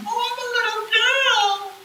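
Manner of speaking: put-on very high, slow sing-song little girl voice; a demon faking a sweet child; fake little girl. Spirit posing as child on Ouija.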